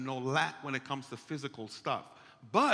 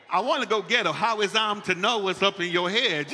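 loud, raised mock outburst; exasperated; 4 semitones higher, 6 dB louder than usual. Husband retelling his reaction to his wife, exasperated. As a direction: protest loudly in playful exasperation.

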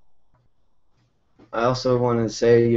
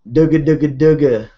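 put-on baby babble; 4 semitones higher, 8 dB louder than usual. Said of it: "she's got me saying".

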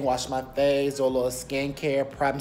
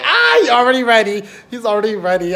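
excited squeal; 9 semitones higher, 12 dB louder than usual. Retold or imitated. Excited reply to Lyft driver.

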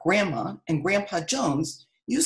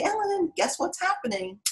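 excited naturalists; 12 semitones higher than usual. Retold or imitated. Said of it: "And they say".

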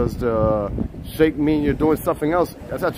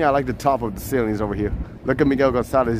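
normal talking voice

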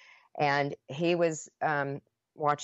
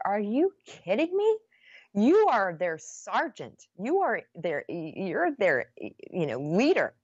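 much higher, loud, slow disbelief; incredulous outrage; 6 semitones higher, 4 dB louder, slower than usual. Retold or imitated. Wife scolding husband who wants to sell drugs, outraged. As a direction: throw it back at him, appalled.

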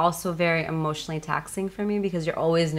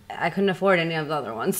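usual speaking voice

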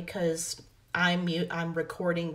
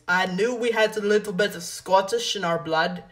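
imitating little brother's Scottish accent, louder; 5 dB louder than usual. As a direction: put on a loud, playful Scottish accent. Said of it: "He said in a Scottish accent".

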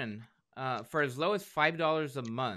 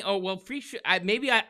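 ordinary talk

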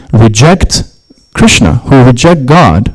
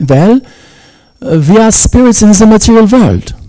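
high-pitched mock German accent; a hammy German accent, pitched up; 11 semitones higher than usual. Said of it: "attempted German accent imitation".

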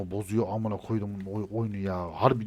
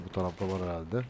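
ordinary talk

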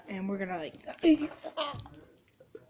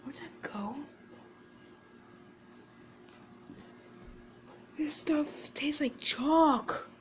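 whispering; 6 semitones higher than usual. Real, not acted. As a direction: whisper it through the sour candy. Whispering while eating sour candy.

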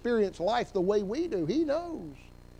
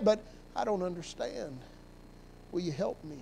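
quiet plea; 9 dB quieter than usual. Pretend. Prayer ends in quiet plea.

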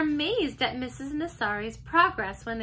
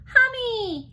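put-on high, loud toddler squeal; excited toddler; 11 semitones higher, 6 dB louder than usual. A narrator's reading. Little boy attempting the hamster's name, gleeful toddler. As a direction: pipe it up like a tiny child.

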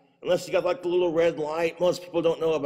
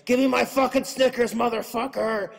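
higher, louder furious demand; angry yell; 7 semitones higher, 4 dB louder than usual. Retold or imitated. Customer screaming at a stuck vending machine, angry yell. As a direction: yell at the machine for your candy. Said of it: "sugar withdrawal attack".

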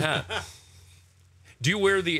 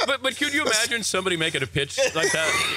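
higher-pitched, laughing incredulity; amused disbelief; 5 semitones higher than usual. Real, not acted. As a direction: stammer it out through real laughter.

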